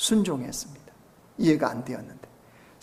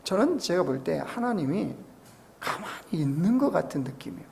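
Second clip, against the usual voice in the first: usual speaking voice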